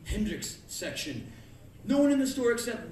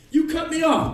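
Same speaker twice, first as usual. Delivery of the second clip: high, loud angry shout; road rage yell; 10 semitones higher, 10 dB louder than usual. Retold or imitated. Driver yelling at another driver, loud.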